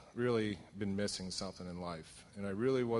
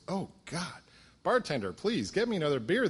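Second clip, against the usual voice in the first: high, fussy complaint to the bartender; fussy light drinker; 7 semitones higher, 3 dB louder than usual. Retold or imitated. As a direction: play a prissy customer upset by a fly. Light drinker complaining about fly.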